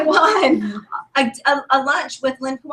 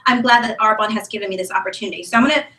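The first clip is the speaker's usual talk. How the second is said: normal talking voice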